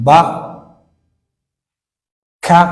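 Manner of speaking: ba and ka each said on its own, clearly with a long pause after; teacher drilling the sound a; syllable drill. Shown as a demonstration. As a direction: say ba then ka as separate model syllables. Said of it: "Haddaba kan wuxuu noqonayaa a".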